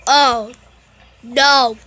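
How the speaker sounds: loud drawn-out oh no, mock dread; loud groan. Real, not acted. Reactor recognizing the next clip, loud mock dread. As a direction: dread the clip he knows is coming. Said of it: "I heard this one".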